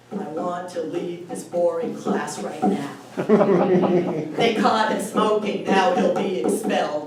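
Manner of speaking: sing-song iambic beat, loud and laughing; loud laughing iambic chant; iambic example. Shown as a demonstration. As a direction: chant it in da-DUM rhythm for the kids. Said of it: "give examples that the kids would understand".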